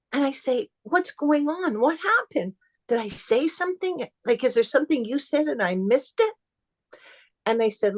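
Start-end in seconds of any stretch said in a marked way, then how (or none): 0.8-6.3 higher, retold bewildered questioning; puzzled. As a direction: replay anxious confusion with raised pitch. Acts out puzzled questions to friends.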